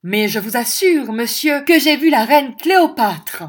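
loud, high-pitched, imperious insistence. A narrator's reading. Hostess assuring the minister at supper of her vision, imperious. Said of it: "d'un ton impérieux".

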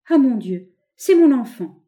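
crying out. A narrator's reading. Woman recognizing her dead child, crying out. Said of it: "une femme s'écria".

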